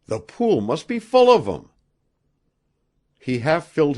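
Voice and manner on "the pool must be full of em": high, loud astonished exclamation; gasped amazement. A narrator's reading. Rod on seeing a larger nugget, amazed gasp. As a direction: burst out in amazement. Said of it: "Rod fairly gasped".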